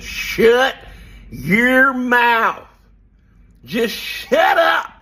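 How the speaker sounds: imitated bossy order, loud, high and drawn out; mock shouted command. Married man mimicking an order to his wife, mock shouted command. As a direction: boom each word in mock authority.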